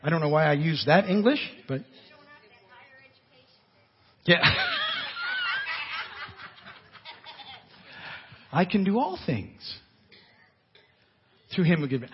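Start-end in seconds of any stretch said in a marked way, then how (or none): 4.4-6.2 high, soft wheezing laugh; drawn-out laugh. Real, not acted. Preacher amused by his own grammar, long laugh. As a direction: laugh helplessly at your own slip.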